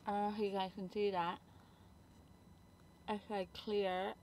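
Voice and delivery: lips stretched by finger; talking with cheek pulled; finger pulling mouth open. Real, not acted. Seen 0: Finger hooks mouth corner, stretching lips to show braces while talking.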